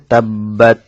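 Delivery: তাব্বাত with a held double ব; doubled b. Shown as a demonstration. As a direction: take time on the tashdid in তাব্বাত. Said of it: "এভাবে উচ্চারণ করতে হবে".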